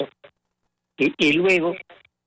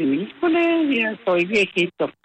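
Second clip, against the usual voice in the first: higher, light mock groan about feeling old; mock dismay; 8 semitones higher, slower than usual. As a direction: playfully lament being old in a higher voice. (lamento burlón, voz más aguda)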